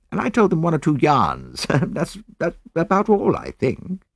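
put-on high, casual young officer with a small laugh; young soldier. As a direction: recall it offhandedly in a lifted voice. Young soldier recalls dinner talk.

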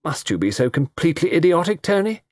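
high, cutting rebuke; scornful question, pitch climbing to the name. A narrator's reading. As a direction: put him down sharply. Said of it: "Pauline Weatherby said cuttingly".

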